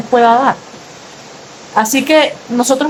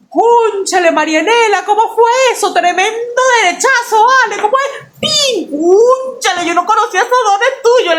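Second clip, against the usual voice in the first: high-pitched, excited mock astonishment; amazed teacher, imitated; 12 semitones higher than usual. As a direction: marvel at the punch as the impressed teacher. Teacher acted marvelling at the punch.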